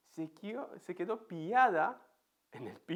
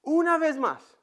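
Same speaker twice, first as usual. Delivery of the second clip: louder, higher firm father; a father's firm one more time, louder; firm final offer; 9 semitones higher, 7 dB louder than usual. Retold or imitated. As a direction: lay down the last chance. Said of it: "Pero digo".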